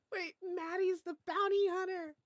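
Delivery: high, loud astonished cry; shocked realization. Real, not acted. Streamer realizing Maddie hunts her, high astonished cry. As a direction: blurt it out in disbelief.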